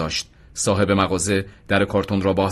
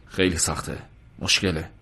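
lower, softer, hesitant refusal; feigned reluctance; 4 semitones lower, 6 dB quieter than usual. A narrator's reading. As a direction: weigh it up quietly. Officer feigns reluctance to relent. (آهسته و با تردید)

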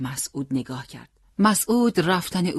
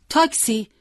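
higher, louder shout for a taxi; calling out; 10 semitones higher, 7 dB louder than usual. A narrator's reading. Boy hailing a passing taxi, calling out. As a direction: hail a cab with a shout. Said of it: "داد زد".

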